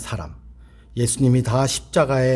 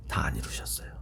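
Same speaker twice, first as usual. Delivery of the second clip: low, much softer, hushed whisper; whispered defiance; 13 semitones lower, 12 dB quieter than usual. Retold or imitated. A believer whispering quietly to Jesus, hushed. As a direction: whisper a stubborn objection.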